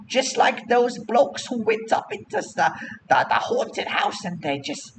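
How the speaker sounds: high-pitched chatty vendor; street hawker. Acted. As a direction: pitch the goods in a high put-on voice. Hawker answers the player's question.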